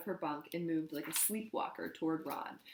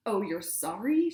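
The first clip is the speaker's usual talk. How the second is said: slow, loud mocking question; scornful; 3 semitones higher, 7 dB louder than usual. A narrator's reading. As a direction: sneer at his apology. Scornful reply to apology.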